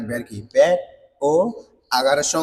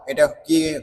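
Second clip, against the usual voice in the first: normal talking voice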